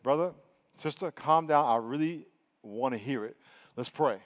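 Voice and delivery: quiet, low pastoral reassurance; calming reassurance. Retold or imitated. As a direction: gently settle a nervous person down. Preacher calms a nervous confronter.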